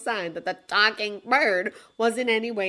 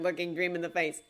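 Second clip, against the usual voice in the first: plain reading voice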